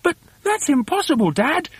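put-on high, protesting child voice; high-pitched little monkey protest; small monkey's cry. A narrator's reading. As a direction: object to Dad in high disbelief. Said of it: "cried one of the small monkeys".

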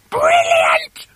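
very high, screechy wife's cry; gleeful cry. Acted. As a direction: shriek with wicked delight. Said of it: "cried Missus Twit".

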